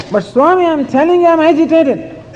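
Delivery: high, loud, pleading protest; agitated disciple. Retold or imitated. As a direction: push it up high and urgent like a fed-up disciple.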